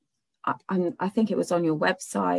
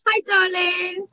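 sweet greeting; 13 semitones higher, slower than usual. Real, not acted. Podcast host greeting a hurt little girl, high slow coo. Greets guest's bleeding daughter.

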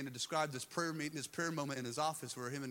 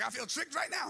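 high, loud imitation of her protest; joking outburst on someone's behalf; mock complaint; 12 semitones higher, 5 dB louder than usual. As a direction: playfully wail the grievance. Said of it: "She's like".

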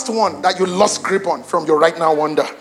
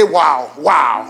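awed wow; 7 semitones lower than usual. Shown as a demonstration. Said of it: "wake up and say".

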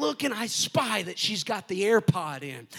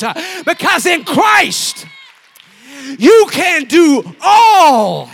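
preaching building to full force; 5 semitones higher, 17 dB louder than usual. Real, not acted. Preacher refuting the devil's lies to youth, building shout. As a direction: build to a loud, high sermon shout.

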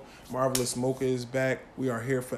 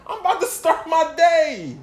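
much higher, louder exasperation; mock indignant; 12 semitones higher, 6 dB louder than usual. Real, not acted. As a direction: scoff at them coming home at dawn. Mocking late-night tweeters.

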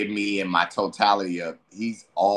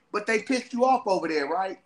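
higher, loose party-friend voice; tempting homie; 9 semitones higher than usual. Acted. Friend inviting him to get high, higher and loose. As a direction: lure your buddy out to get high. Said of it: "and they like".

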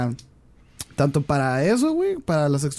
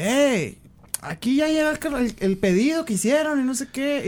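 lightly voiced caller, pitched higher; delivery man voice; 8 semitones higher than usual. Delivery driver calling the print shop, a hint of a higher caller voice. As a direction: play the pushy delivery guy with a higher voice.

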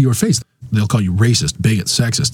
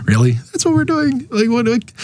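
mock disbelief; 13 semitones higher than usual.

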